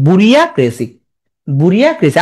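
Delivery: বুরিয়াক্রেসি said as bu-ri-ya-cracy; memory-aid pronunciation. Shown as a demonstration. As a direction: model বুরিয়াক্রেসি as it is spelt. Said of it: "তাহলে তুমি এমনি বলো". (বানান অনুযায়ী বুরিয়াক্রেসি)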